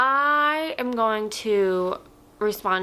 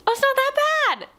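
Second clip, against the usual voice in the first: squeaky and much higher; 3 dB louder than usual. Shown as a demonstration. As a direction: squeak it out way up high. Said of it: "It's really not that bad".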